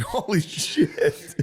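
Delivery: slow, drawn-out mock awe; slow, stunned mock exclamation; mock amazement. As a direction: stretch it out in mock disbelief. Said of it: "every once in a while I'll see it and be like".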